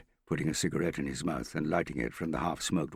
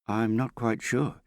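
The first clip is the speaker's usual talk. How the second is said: slow, higher, hesitant apology; apologetic; 7 semitones higher, 4 dB louder, slower than usual. A narrator's reading. Hester answers Morgan unsure about Harry, apologetic. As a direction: draw it out as a mild apology. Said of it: "she said apologetically".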